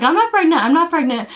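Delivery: retold protesting denial; insistent denial. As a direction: deny it twice, high then easing down. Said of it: "So I, like, denied".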